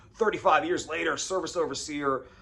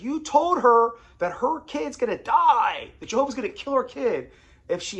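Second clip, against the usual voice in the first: accusing; 10 semitones higher, 8 dB louder than usual. Retold or imitated. Church lady accusing him, loud and high. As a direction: hurl the blame at him.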